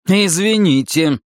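high, drawn-out, insincere sorry; pointed, defiant apology; unapologetic apology. A narrator's reading. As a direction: say sorry without meaning it at all. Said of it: "заявил я тоном, никак не подходящим для извинений".

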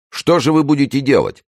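high, agitated question; urgent demand. A narrator's reading. Pelisson pressing the superintendent, urgently. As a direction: demand an answer, pitch shooting up.

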